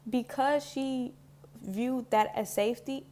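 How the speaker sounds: ordinary delivery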